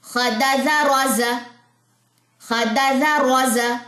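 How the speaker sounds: slow, sing-song syllables; rhythmic reading. Shown as a demonstration. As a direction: read it in one steady rhythm. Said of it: "लय में पढ़ेंगे".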